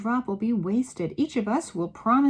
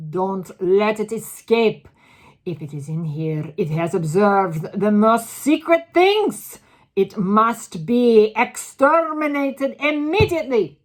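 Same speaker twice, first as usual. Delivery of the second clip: menacing loud; 3 semitones higher, 11 dB louder than usual. A narrator's reading. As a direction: order it coldly and loud. Grand High Witch orders extermination.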